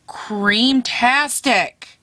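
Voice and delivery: very slow, savoured creamtastic; stretched-out creamtastic. Real, not acted. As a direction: draw out creamtastic lovingly. Coined word stretched.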